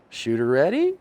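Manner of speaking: drawn-out call rising at the end; range officer call. Acted. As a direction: call the shooter to readiness. Imitated shooting range call.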